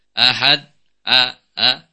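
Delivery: ahad with a firm but moderate a; moderate hamzah. Shown as a demonstration. Teacher showing the balanced hamzah. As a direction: press the a just moderately. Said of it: "Tetapi pertengahan".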